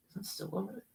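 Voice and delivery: very quiet, half-whispered aside; talking to himself. Real, not acted. Streamer hunting for a DVD, talking to himself under his breath. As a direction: think aloud under your breath.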